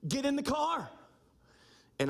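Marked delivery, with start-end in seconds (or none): 0.1-0.9 put-on mom ordering the kids. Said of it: "she told our children".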